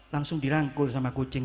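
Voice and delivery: ordinary delivery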